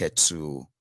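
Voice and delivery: ordinary delivery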